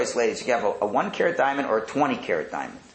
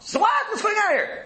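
much higher, indignant disbelief; outraged guest. Acted. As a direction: cry out at the bill like a cheated guest.

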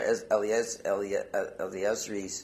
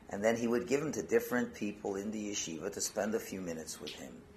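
ordinary talk, nothing marked

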